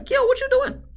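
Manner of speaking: high-pitched crying wail. Retold or imitated. Recapper wailing at Kill in mock despair.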